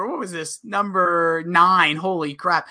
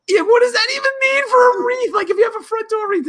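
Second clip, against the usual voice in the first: squeaky high-pitched mockery. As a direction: pitch it way up, mocking. Mocking the sketch guy.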